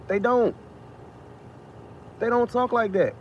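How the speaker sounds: ordinary talk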